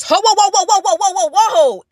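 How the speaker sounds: high, loud whoas through a laugh. Real, not acted. Hearing the boss call Diana his ex, loud laughing whoas. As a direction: laugh out a shocked stop-stop. Shocked reaction to ex reveal.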